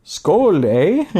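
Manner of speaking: Skål, eh in hammed-up Canadian accent; a playful Canadian accent, eh. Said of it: "Me with my Canadian accent".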